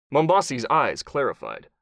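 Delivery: ordinary delivery, nothing marked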